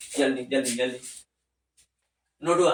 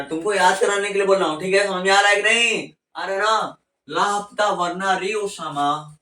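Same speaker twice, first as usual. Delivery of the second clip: louder and faster, pressing the class; loud insistent push; 4 semitones higher, 4 dB louder than usual. Real, not acted. Teacher pushing students to follow, loud and fast. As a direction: demand they get it, louder and quicker.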